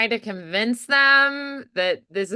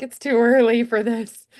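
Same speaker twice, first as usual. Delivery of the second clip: groggy complaint; 2 semitones higher, 5 dB louder than usual. Shown as a demonstration. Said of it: "They're like".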